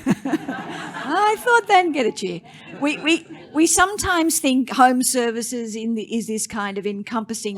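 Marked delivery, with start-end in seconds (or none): 0.1-2.4 higher, drawn out through real laughter; laughing. Real, not acted. Host joking that she expected a cheer, laughing. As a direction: say it while laughing. Host's joke falls short, laughs.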